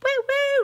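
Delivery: a loud, very high woo; squealed delighted woo; cheesy woo. Real, not acted. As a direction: whoop at the top of your voice. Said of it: "that was super cheesy".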